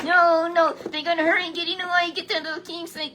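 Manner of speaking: high, loud, fast frantic cry; panicked queen. Acted. Athaliah hears the crowning, a high loud frantic cry. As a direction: shriek it out as the alarmed grandmother.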